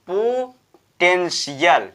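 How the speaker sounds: slow पोटेंशियल, broken as po, ten-shiyal; syllable-by-syllable sh reading. Shown as a demonstration. Teacher showing how to read it, slow पोटेंशियल with sh. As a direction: draw out पोटेंशियल with a clear "shi-yal". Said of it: "इसको हम पढ़ेंगे".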